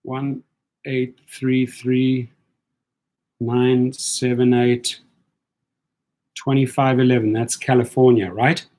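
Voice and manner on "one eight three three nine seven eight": digits said slowly, one by one; slow careful number read; slow dictation. Shown as a demonstration. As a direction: spell out each digit slowly for writing. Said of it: "Let me just write that down".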